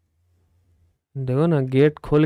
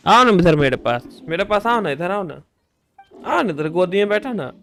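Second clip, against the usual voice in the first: coaxing a pet; 4 semitones higher, 7 dB louder than usual. Real, not acted. Coaxing the cat to come over.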